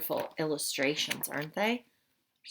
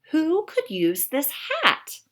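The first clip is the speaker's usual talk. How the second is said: puzzled musing; 9 semitones higher, 7 dB louder, slower than usual. Acted. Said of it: "thought Mister George".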